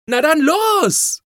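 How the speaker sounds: high, loud, drawn-out cheer; jubilant shout. A narrator's reading. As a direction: yell it like a child raring to go. Anna jumps up, cheering.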